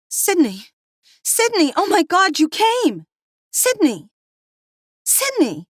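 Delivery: high, loud relieved calls to her husband; wife calling out in relief; joyful calling out. A narrator's reading. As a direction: shout his name across the park. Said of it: "It was Jessica's voice".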